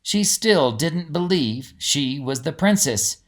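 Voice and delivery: plain reading voice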